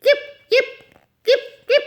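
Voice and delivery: very high, loud little yips; piercing lapdog yelps; dog yapping. A narrator's reading. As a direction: yap like a small dog. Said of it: "Andrew yapped".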